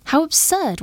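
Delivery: high-pitched exclamation of disbelief; scoffing cry. A narrator's reading. Lady rejecting talk of Mister Jones's ruling, scoffing cry. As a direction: cry it out high, dismissing the idea. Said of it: "one of the ladies cried".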